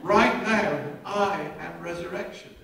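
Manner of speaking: Jesus proclaiming to Martha, loud. Retold or imitated. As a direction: announce it with full force.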